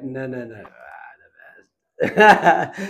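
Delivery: hammed-up modest protest bursting out loud; mock modesty. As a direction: play at waving off praise, then burst out. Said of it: "I'd try to be humble".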